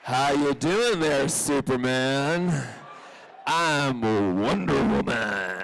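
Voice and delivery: loud, very drawn-out comic greeting; hammed-up superhero voice. Playing dressed-up superheroes greeting each other, loud and campy. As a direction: stretch it out like a campy costumed hero.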